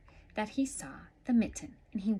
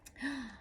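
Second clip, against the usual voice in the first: soft gasp; 5 semitones higher, 5 dB quieter than usual. Acted. Reacting to story suspense.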